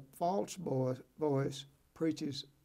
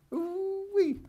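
a very high, far-off squeal of delight; high ooh-wee of pure joy; excited whoop; 3 dB louder than usual. Real, not acted. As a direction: let out a gleeful ooh-wee. Excited exclamation about his choice.

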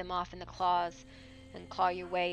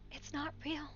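pitched up, pleading with herself; rising frightened plea; 8 semitones higher, 8 dB quieter than usual. Acted. Rose trembling in the cave, pleading higher to herself. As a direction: say it higher, desperate to believe it.

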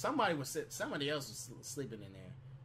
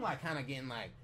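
ordinary talk

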